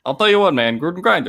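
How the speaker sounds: lightly voiced coach catchphrase; a hint of Gruden, mostly own voice; Gruden impression. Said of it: "it's a good impression".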